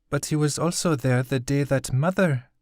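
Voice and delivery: voiced high like a young girl, gentle; high girl's voice. Girl reassures the grieving craftsman, high and gentle. Okizi recalls her mother's death.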